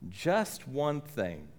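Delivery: one thing drawn out low and slow; slow emphatic Curly. Retold or imitated. Cowboy pressing the point home to Mitch, slow and weighty. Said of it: "He says".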